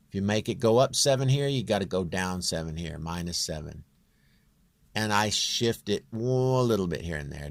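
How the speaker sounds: usual speaking voice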